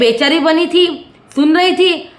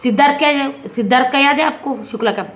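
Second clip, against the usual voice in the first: ordinary delivery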